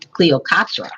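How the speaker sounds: ordinary delivery, nothing marked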